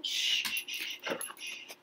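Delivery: soft shushing. Real, not acted. Hushing the baby.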